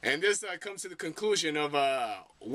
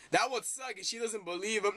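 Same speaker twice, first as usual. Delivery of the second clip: ordinary talk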